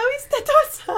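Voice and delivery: high, slow, drawn-out amused tease; amused mock emphasis. Real, not acted. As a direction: stretch it out, mocking the typo. Laughing at misspelled card name.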